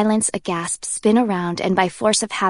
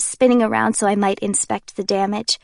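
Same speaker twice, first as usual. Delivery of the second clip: plain reading voice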